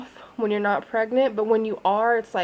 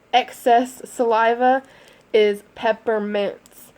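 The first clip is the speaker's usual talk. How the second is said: normal talking voice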